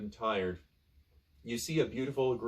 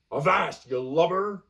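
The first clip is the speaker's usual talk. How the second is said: loud, higher pirate bark; gruff pirate; 5 semitones higher, 6 dB louder, slower than usual. Acted. Pirate hails the thirsty castaway, loud and gruff. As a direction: growl it like a salty pirate. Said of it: "says the pirate".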